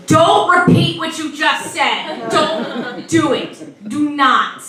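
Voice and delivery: usual speaking voice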